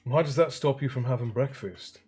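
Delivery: ordinary delivery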